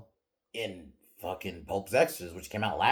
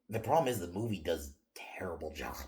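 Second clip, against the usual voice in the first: usual speaking voice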